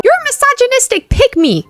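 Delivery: high, shrill-pitched mocking Swiftie voice; mock angry Swiftie. Imagined Swiftie commenter attacking the vlogger, mock high whine. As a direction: squeak it out like an outraged fan commenter. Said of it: "I can hear the Swifties ... typing".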